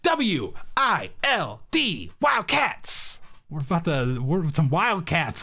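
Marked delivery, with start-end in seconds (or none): 0.0-3.2 excited announcement. Pretend.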